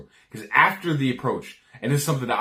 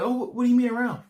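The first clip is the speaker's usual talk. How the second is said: much higher, fast mock girl voice; girl's reply; 12 semitones higher, faster than usual. Guy acting the intrigued girl, high quick mock voice. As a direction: squeak out her puzzled question. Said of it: "And she'll be like".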